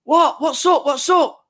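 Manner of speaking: high, loud stunned "Oh, no. What?"; reenacted shocked reaction. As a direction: relive the moment the draw came out. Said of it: "And I literally just went".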